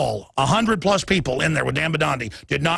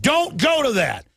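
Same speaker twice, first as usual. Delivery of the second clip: higher, slow mock command; mock godlike order; 8 semitones higher, slower than usual. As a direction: order it like a haughty god. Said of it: "they think they're God".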